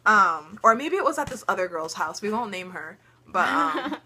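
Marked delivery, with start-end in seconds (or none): none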